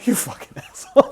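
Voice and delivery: high, breaking into laughter; laughing insult. Real, not acted. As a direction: curse a friend while cracking up. Laughing after being fooled by co-host.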